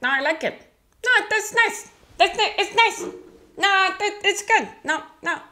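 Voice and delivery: high reedy impression of a relative; high mock Albert voice; imitating Albert. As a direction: squeak it out, politely insisting. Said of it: "Albert also has that voice".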